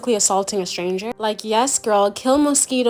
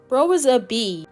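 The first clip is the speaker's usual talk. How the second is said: ordinary delivery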